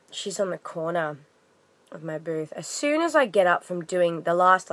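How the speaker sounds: usual speaking voice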